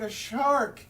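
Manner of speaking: high, loud alarmed cry. Acted. Penguin spotting a shark, alarmed cry. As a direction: spot the shark and panic.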